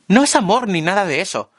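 high shouted confession. A narrator's reading. Marla shouts her feelings to the narrator, high. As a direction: call it across the roof. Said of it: "grita Marla".

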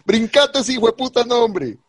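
high-pitched exasperated outburst; fed-up, high and insistent; exasperated urging. Real, not acted. Exasperated co-host urges skipping the name.

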